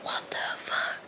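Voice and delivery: breathless whisper of disbelief. Retold or imitated. Girl whispering across the dark dorm, stunned. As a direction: mouth it in a hushed whisper. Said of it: "she was like".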